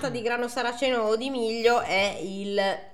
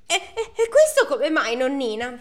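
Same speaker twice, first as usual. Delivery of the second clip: mock alarm; 5 semitones higher, 6 dB louder than usual. Daughter alarmed at the blood.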